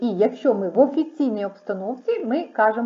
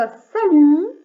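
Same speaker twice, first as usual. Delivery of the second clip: bright salut; 6 semitones higher, 10 dB louder than usual. Shown as a demonstration. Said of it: "ми кажемо просто".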